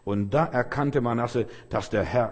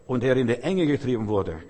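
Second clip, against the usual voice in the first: ordinary talk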